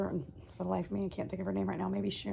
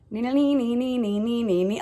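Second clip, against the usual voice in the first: high, loud sung banjo twang; Deliverance banjo hum; 6 semitones higher, 7 dB louder than usual. Retold or imitated. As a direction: mimic the creepy banjo tune. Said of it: "Deliverance playing in my head".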